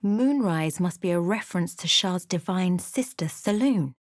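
usual speaking voice

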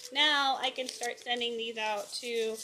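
ordinary talk, nothing marked

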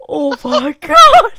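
high, loud, slow, laughing; laughing exclamation. Real, not acted. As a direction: burst out laughing mid-word. Reacts to the cows.

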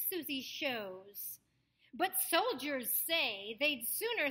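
slow recital with each S clear in SISTER SUSIE SEWS; measured, lilting tongue twister, sibilants crisp in SAUCY SILKY SHIRTS; careful S's. Shown as a demonstration. Coach gives a long one to practice, SISTER SUSIE carefully enunciated. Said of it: "With the S's again as sounds".

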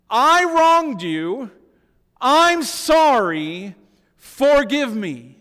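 slow, loud, heartfelt apology; slow deliberate confession; earnest confession. Shown as a demonstration. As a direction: lean on each word, pleading. Preacher models confession slowly.

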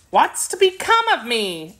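uneasy. A narrator's reading. Horse unsure of his place in the palace, uneasy question. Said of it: "asked the horse uneasily".

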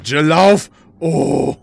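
frantic shout at Jill to run; being chased. Real, not acted. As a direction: yell run in panic.

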